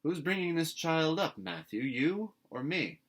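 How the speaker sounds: a stern woman scolding her brother; sharp challenge. A narrator's reading. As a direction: put him in his place. Marilla challenging.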